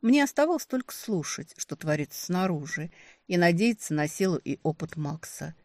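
plain reading voice

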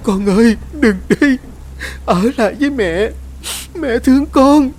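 high, loud, grief-stricken cry; anguished wail. A narrator's reading. Mother wailing over her dying newborn, high anguished cry. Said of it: "gào lên trong đau đớn".

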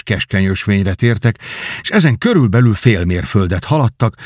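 ordinary delivery

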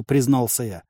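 ordinary delivery

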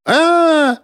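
high, drawn-out pained cry; scream of pain. A narrator's reading. Beaten man screams in pain.

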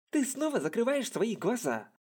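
high scolding yell. Acted. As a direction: snap at her for closing her eyes. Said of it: "закричал он".

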